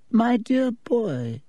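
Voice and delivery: put-on slow, high, gentle old man; kindly old headmaster. Dippet addressing the nervous prefect, slow and kindly. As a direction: draw it out fondly like a grandfather. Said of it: "said Dippet kindly".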